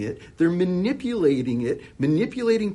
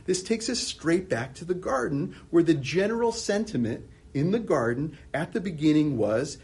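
ordinary talk, nothing marked

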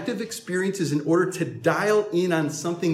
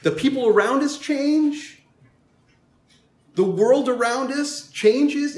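higher, louder pulpit voice; fervent preacher's build; preaching; 8 semitones higher, 3 dB louder than usual. Acted. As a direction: build it like a sermon. Preacher's raised refrain.

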